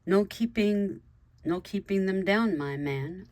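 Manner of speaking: ordinary delivery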